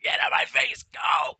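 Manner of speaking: loud barked order, pitch jumping high; furious drill instructor shouting at the recruit; drill instructor yelling. Retold or imitated. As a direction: yell him out of your face. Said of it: "the drill instructor's like".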